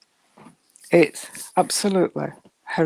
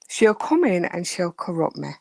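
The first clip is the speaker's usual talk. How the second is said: normal talking voice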